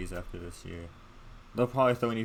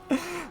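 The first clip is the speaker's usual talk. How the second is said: high-pitched quick giggle. Real, not acted. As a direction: burst into a squeaky giggle. Laughs mid rant.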